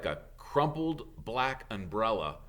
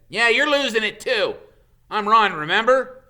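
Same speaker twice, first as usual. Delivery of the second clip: much higher and louder, mock-indignant; exasperated retort; 12 semitones higher, 13 dB louder than usual. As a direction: scoff at Harry.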